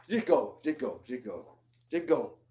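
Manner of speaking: low, soft, fading just go; a tired, quiet send-off; weary dismissal. Real, not acted. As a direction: wave him off, worn out. Weary dismissal after sigh.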